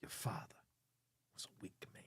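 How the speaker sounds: the whole line unvoiced, in a low whisper; whispered Walken line. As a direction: hiss it like a creepy secret. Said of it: "like an angry Walken?".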